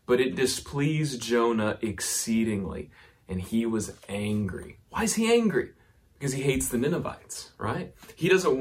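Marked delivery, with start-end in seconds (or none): 0.1-4.8 slow, deliberate scripture reading; unhurried reading for the congregation. Shown as a demonstration. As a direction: let each word land, read it slowly. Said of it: "Read, read with me slowly".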